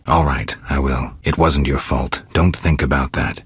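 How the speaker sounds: usual speaking voice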